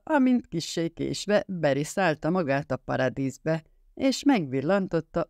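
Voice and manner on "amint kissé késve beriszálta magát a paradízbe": ordinary delivery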